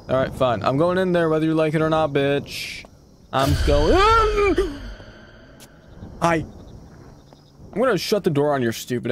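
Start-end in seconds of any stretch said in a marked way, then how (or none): 4.0-4.9 sharp scared gasp. Real, not acted. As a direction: gasp as the scare hits you. Scared by jump scare in game.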